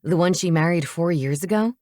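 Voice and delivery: usual speaking voice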